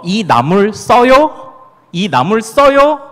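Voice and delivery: slow, even Seoul Korean; standard Seoul question. Shown as a demonstration. Pastor setting up the Seoul version before the dialect, slow Seoul speech. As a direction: ask it slowly, plain Seoul way. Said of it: "이거를 뭐라고 해요?".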